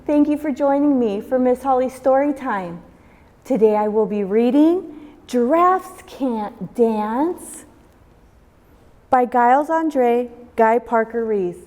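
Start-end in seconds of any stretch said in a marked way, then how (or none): none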